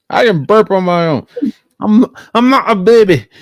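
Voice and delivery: high, loud whiny protest; mock indignant shout. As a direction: pretend to be offended, loud.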